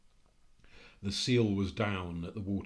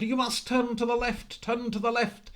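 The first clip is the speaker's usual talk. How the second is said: very high, loud, strained cry; the same warning, now frantic as the horse speeds on; desperate panicked shout; 8 dB louder than usual. Acted. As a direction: scream the warning in full terror. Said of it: "and again Angus said".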